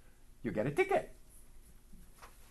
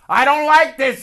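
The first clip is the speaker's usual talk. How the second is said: louder, higher whiny protest; loud complaint; 11 semitones higher, 14 dB louder than usual. Retold or imitated. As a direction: grumble it loudly like a sulky brother. Brother complaining after reading.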